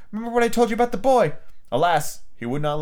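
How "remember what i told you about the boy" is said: high, fast feminine plea; urgent wife. A narrator's reading. Wife reminds husband about the boy, urgent. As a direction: hurry the reminder out. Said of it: "Missus Darling whispered".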